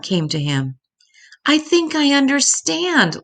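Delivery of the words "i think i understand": crying out. A narrator's reading. Little Star realising the truth about the baby, high excited cry. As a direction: burst out with the discovery. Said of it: "Little Star cried out".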